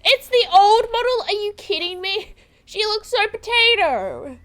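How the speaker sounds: high, loud mock outrage; indignant disbelief. Real, not acted. Streamer complaining about the old horse model, outraged. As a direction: protest in playful disbelief.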